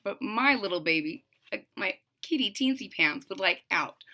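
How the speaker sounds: ordinary delivery, nothing marked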